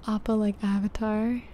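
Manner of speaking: normal talking voice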